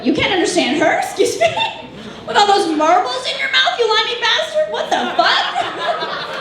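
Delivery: high, laughing comic outrage; indignant comeback. Acted. Defending coworker to rude customer, indignant.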